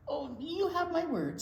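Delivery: mouse voice. Acted. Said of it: "said Mouse".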